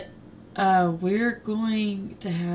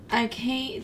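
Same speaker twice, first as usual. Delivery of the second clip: ordinary talk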